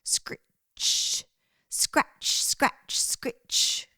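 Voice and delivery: whispered scritch scratch; a voiceless scraping hoe sound; hoe scraping noise. A narrator's reading. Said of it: "he heard the noise of a hoe".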